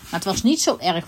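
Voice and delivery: usual speaking voice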